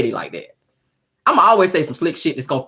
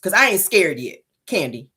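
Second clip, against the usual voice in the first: normal talking voice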